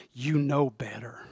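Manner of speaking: hushed whispered rebuke; a slow whispered scolding, face to face. Retold or imitated. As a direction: whisper it slowly and pointedly. Paul confronts Peter face to face.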